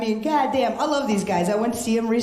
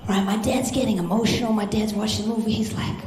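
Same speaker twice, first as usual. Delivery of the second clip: ordinary delivery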